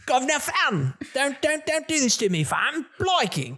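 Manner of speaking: high, hammed-up Cockney caricature; mock Cockney accent. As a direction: parody the game's fake London slang in Cockney. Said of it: "I'm obviously not British".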